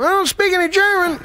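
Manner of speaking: high, squeaky plea; fake-awake teacher. Sleepy teacher voiced on phone.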